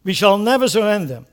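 loud, high defiant oration; a defiant rally line; Churchill quote. Retold or imitated. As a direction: stamp out never surrender. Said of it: "I love that attitude".

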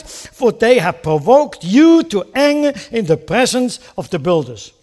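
loud, slow, imploring scripture prayer; Nehemiah's prayer, loud and slow; fervent prayer reading. Acted. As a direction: cry it out to God.

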